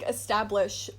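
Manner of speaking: normal talking voice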